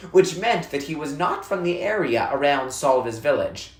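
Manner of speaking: plain reading voice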